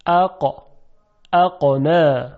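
اقا with a hard uvular stop on the q; cut-off qaf. Shown as a demonstration. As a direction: cut the sound sharply at the ق of اقا.

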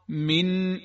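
ordinary talk, nothing marked

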